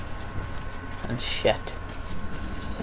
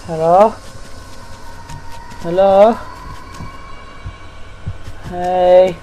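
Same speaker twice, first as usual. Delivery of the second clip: nervous calling out; 5 semitones higher, slower than usual. Real, not acted. Calling out in dark game.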